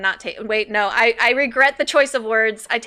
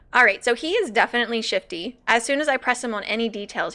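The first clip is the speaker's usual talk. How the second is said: ordinary delivery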